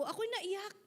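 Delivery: usual speaking voice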